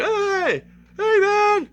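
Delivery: high, loud looping "hey" yell; imitated calling out. Showing how the neighbour kept looping, imitated high yell. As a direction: holler it like the man on repeat.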